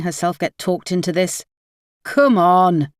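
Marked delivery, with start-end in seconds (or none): 2.0-2.9 calling out. A narrator's reading. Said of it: "called Ryan".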